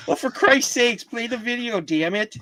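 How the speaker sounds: hammed-up Midwestern Wisconsin gripe; Wisconsin accent. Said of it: "Maybe you should tell them in a Wisconsin accent".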